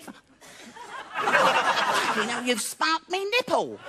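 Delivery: hammed-up high female protest; indignant woman act. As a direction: squawk it as the shocked girlfriend. Acts woman reacting to static shock.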